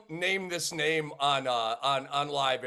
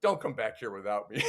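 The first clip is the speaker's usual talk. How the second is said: smirking warning; 8 semitones lower, 4 dB quieter than usual. Retold or imitated. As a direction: lower voice, teasing 'don't come back' with a smirk. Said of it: "he said it with a smirk".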